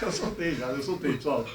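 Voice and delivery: hushed, keeping voice down; soft murmur near a sleeper; quiet at night. Real, not acted. Friend asleep, pranking quietly.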